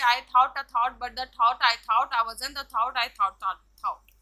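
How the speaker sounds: tongue twister demo. Shown as a demonstration. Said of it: "example कुछ टंग twister का दिया हुआ है".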